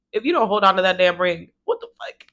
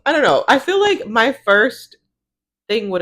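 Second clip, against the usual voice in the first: louder disbelief; 6 semitones higher, 6 dB louder than usual. Real, not acted. Incredulous at her bag choice.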